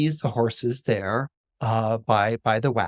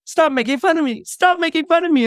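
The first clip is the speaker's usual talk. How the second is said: high, loud protest; wounded boy yelling, pitched way up; upset pleading; 14 semitones higher, 5 dB louder than usual. Acted. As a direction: wail it at the bullies. Boy pleads with jeering schoolmates.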